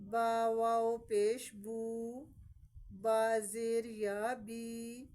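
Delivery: slow, loud, syllable by syllable like a Qaida teacher; slow Qaida spelling drill, every letter stretched; slow spelled-out reading. Shown as a demonstration. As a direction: spell each letter out slowly and clearly for children. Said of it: "ہم اس کو کیسے پڑھیں گے؟".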